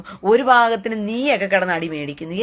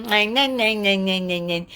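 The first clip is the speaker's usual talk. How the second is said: mock whimpering "nya"; much slower than usual. Mimicking a whiner.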